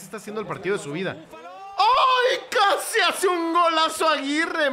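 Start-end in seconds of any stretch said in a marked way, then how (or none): 1.8-4.7 very high, loud cry, stretched out; stunned shout. Real, not acted. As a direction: yell in shock at a near goal. Reacts to a near goal. (grito agudo de asombro)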